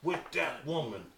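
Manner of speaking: lightly voiced Bill Clinton; Clinton impression. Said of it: "the way that he said it".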